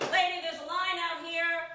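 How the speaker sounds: high, loud impatient yell through a door; impatient stranger. Retold or imitated. Stranger outside the bathroom yells at her, high and loud. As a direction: bang on the door and holler at her.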